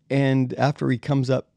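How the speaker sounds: ordinary talk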